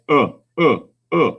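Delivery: isolated short 'uh', repeated in bursts; short, separate 'uh' vowels; short U sound. Shown as a demonstration. Teacher explains closed syllables, short U as 'uh'. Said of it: "vowel is going to be short".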